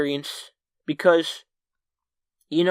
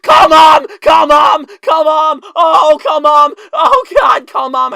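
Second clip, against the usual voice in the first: high, screamed, tearful pleading; crying in panic; 13 semitones higher, 14 dB louder, slower than usual. Retold or imitated. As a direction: scream it in hammed-up tearful desperation. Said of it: "I started crying".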